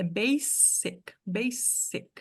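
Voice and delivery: basic slowed down, with a plain S sound, not Z; slow English demo. Shown as a demonstration. Teacher stressing the S in basic for students. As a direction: say basic slowly with a hard S. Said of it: "um S normal".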